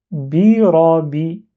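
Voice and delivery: "birabi" read slowly with a single, unblocked b before the final i; without shadda. Shown as a demonstration. Teacher reading the three letters as composed, "birabi" without doubling. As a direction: draw out "birabi", vowels long, no hold on the b. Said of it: "Le mot que je viens de composer là".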